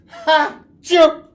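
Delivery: explosive, very loud acted sneeze; huge sneeze. Wolf sneezing down the straw house, huge loud sneeze. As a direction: blast out a giant sneeze. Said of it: "I sneezed a great sneeze".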